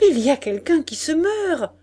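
urgent cry, pitched well up; alarmed exclamation. A narrator's reading. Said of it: "qui s'écria".

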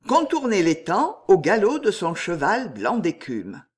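ordinary delivery

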